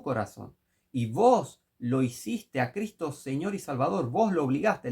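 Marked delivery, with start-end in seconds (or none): none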